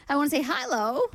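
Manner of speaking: playful mystery accent on I wanna say hello; odd put-on accent. Shown as a demonstration. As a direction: throw on some unplaceable accent for the greeting. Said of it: "I don't know what that accent was".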